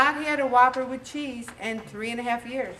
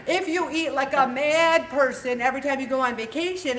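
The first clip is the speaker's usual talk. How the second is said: usual speaking voice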